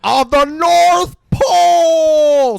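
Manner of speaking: high, loud, drawn-out slow cry; hammed-up shout. Friend echoing the hobo's boast, loud and slow. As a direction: belt it out like a mad king.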